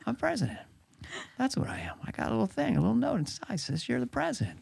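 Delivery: a hint of a confused old president; Biden impression. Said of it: "Okay, here we go".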